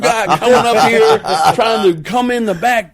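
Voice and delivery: high, loud outraged rant; angry boss. Retold or imitated. Boss scolding about the clown show, loud and outraged. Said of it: "My boss was like".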